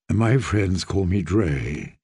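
put-on a gentle young lord bowing to a girl; low courtly knight. A narrator's reading. Knight greets the child queen, low and courtly.

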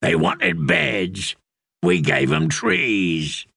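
the Hound boasting of hangings, slow and grim. Acted. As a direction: let the threat sink in slowly. The hound speaks again.

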